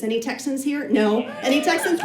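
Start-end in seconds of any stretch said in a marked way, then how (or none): none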